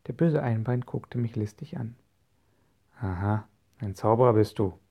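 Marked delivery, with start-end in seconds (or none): 3.0-4.8 sly, growled pirate voice; old sea robber sizing up a rival; growling pirate. A narrator's reading. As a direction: growl it with crafty interest. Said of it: "knurrte er".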